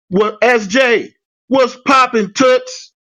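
high, slow, hyped-up greeting. Retold or imitated. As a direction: call it out like an excited hello. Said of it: "I would type".

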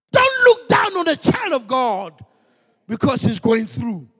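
slow, loud pulpit warning; forceful preaching. Acted. Preacher warning the church about looking down on the poor, forceful. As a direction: hammer the warning home to the congregation.

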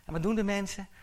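ordinary talk

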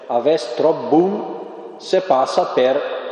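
slow, deliberate Milanese dialect saying. Retold or imitated. Preacher quoting a Milanese dialect proverb, slowly. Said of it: "in dialetto".

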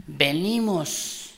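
Venimos stretched very slowly, falling at the end; drawn-out word. Shown as a demonstration. Preacher modeling the word Venimos slowly for the congregation. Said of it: "diga, "venimos".

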